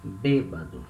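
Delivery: "Bêbado" as "BAY-ba-du", no glide; closed accented E. Shown as a demonstration. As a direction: stress a narrow first e in "Bêbado". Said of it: "will have the sound of E".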